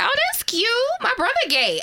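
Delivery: lightly high cooing tone; high sweet mock. Sister imitating her own reaction, in a light high coo. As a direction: coo it like it's adorable. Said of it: "that's exactly what she did".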